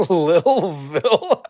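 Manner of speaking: slow, high giggly question through laughter; laughing. Real, not acted. Laughing at a chat nickname, giggly and high. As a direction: laugh the name out in disbelief.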